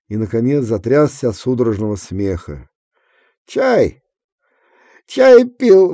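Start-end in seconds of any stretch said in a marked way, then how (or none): 3.5-5.9 high, loud words through laughs; through laughter. A narrator's reading. Drunk Kuzma boasts to Efrem, laughing high. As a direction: push it out between laughs. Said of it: "выговорил он сквозь смех".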